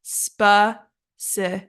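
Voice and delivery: "specific" broken slowly into spe, ci, fic; careful slow "specific", a gap after each syllable. Shown as a demonstration. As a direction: say "specific" in three separate slow beats. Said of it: "the correct pronunciation is".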